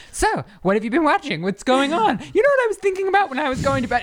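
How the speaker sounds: high, eager bedtime chatter; chatty pestering. Retold or imitated. Wife to sleepy husband at bedtime, eager and chatty. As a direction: pester brightly with rising excitement, speeding up.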